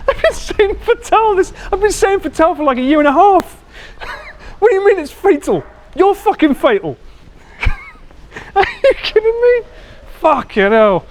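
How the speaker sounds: high-pitched squealing outrage, laughing through it; outraged disbelief. Real, not acted. Streamer learns a viewer's real name, high shocked outrage.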